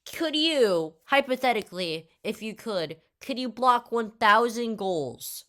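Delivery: mildly hammed-up pestering of a little kid; lightly voiced crazy kid. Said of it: "these stupid questions".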